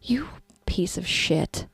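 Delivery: breathy hushed insult; whispered curse. Real, not acted. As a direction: spit it out in a whisper. Cursing the client under her breath.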